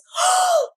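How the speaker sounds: sharp, very high gasp of surprise; a little girl gasping in wonder; child's gasp. Retold or imitated. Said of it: "and she goes".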